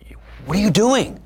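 sharp mock director, rising high; imitating the director. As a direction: bark it like a scornful director. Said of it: "she says".

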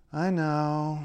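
"I know" drawn out on a level tone; varied intonation. Shown as a demonstration. Linguist showing how intonation changes meaning, long level "I know". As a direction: hold "know" long and even, barely moving. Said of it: "your intonation can make a huge difference".

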